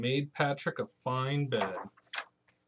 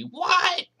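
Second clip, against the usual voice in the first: high, loud put-on what; playful what for Mommy; mock granny cry; 7 dB louder than usual. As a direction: imitate granny's shocked cry. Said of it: "don't tell Mommy".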